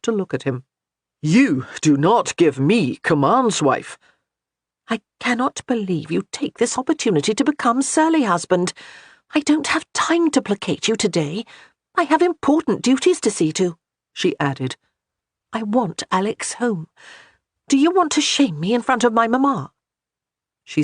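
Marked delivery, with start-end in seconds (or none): 1.2-4.0 slow, louder, lightly voiced stern laird; stern husband. A narrator's reading. Gabriel asserts authority. 4.9-19.7 higher, a hint of an indignant wife; scolding wife. A narrator's reading. Johanna scolds.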